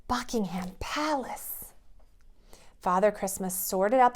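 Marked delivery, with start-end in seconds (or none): 0.1-1.7 hushed amazed, slow; children awed at the palace. Acted. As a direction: say it in wonder.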